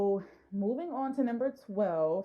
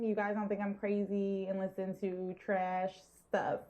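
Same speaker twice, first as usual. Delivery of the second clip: usual speaking voice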